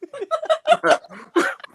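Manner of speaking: loud high burst of laughter. Real, not acted. Man cracking up after his punchline, loud high laugh. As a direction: cackle at the punchline.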